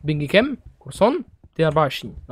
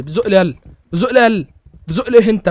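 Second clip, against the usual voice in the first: loud, higher angry yelling; angry shout; 4 semitones higher, 7 dB louder than usual. Real, not acted. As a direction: snap at the player who keeps pushing. Yelling at a pushing player. (صراخ غاضب على اللاعب اللي بيزق)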